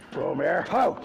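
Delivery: sharp loud whoa, rising to a shout; calling out to a horse. Real, not acted. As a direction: call the horse to stop, loud. Horse moving, vet calls out.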